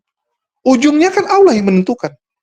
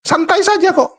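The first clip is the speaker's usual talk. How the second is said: mock casual bravado; 8 semitones higher than usual. Mocking prisoner's bravado.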